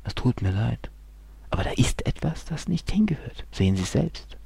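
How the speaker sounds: hushed whisper of bad news; a doctor whispering grim news; doctor's bad news. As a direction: deliver a grave diagnosis quietly. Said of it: "Und dann".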